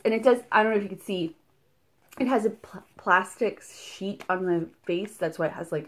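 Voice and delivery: ordinary talk, nothing marked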